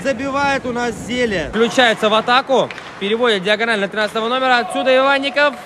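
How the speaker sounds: usual speaking voice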